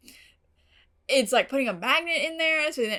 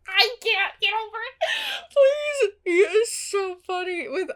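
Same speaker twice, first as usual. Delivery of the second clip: high-pitched giggling through the words; can barely talk for laughing; 10 semitones higher, 5 dB louder than usual. Real, not acted. Fan gushing about Spike on camera, laughing.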